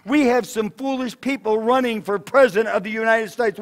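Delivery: ordinary delivery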